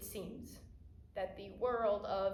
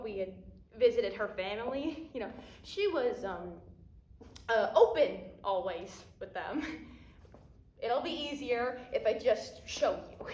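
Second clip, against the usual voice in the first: ordinary talk, nothing marked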